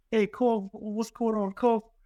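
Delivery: high, strong mock New Zealand accent; imitating dad's New Zealand greeting. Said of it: "strong New Zealand".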